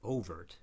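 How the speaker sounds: "overt" said as OH-vert; first-syllable stress. Shown as a demonstration. Demonstrating the stress rule, first-syllable stress. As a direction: lean on the opening oh of "overt". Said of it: "stress only on the first syllable".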